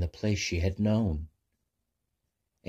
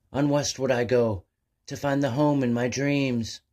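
a called-out cry, higher and louder; yelling back; 7 semitones higher, 4 dB louder than usual. Acted. Abigail calls out to the sea winds, hopeful yell. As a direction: call out over the waves full of hope. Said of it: "she yelled back at the sea".

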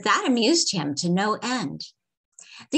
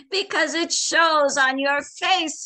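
chuckling; 9 semitones higher, 5 dB louder than usual. A narrator's reading. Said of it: "he chuckled".

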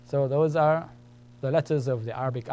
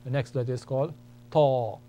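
ordinary talk

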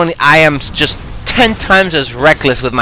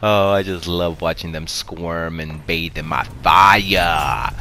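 evil villain; 7 semitones lower, 5 dB quieter, slower than usual. Pretend. Gloating villain line after kill.

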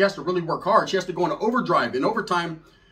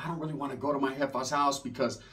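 softer, lower, timid voice; 5 semitones lower, 8 dB quieter than usual. Retold or imitated. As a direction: mumble it shyly. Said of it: "She was like".

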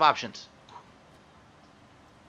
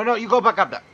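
raised, higher voice barking orders; bossy shout; 8 semitones higher, 7 dB louder, faster than usual. Real, not acted. Gamer ordering his little creatures around, loud and bossy. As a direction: order them back up loudly. Bossing game characters around.

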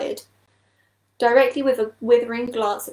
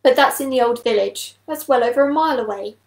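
louder, protesting exclamation; exclaiming; 2 semitones higher, 6 dB louder than usual. A narrator's reading. Badger objects to the distant pond, exclaiming. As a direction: object with some force. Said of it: "exclaimed Badger".

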